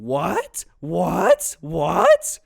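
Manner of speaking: mom's shock building with each what; frantic what. Retold or imitated. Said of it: "very frantically going".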